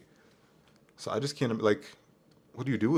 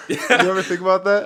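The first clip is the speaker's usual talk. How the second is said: a laughing question, louder and higher; 8 semitones higher, 7 dB louder than usual. Real, not acted. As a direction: tease through a laugh. Laughing at the accent idea.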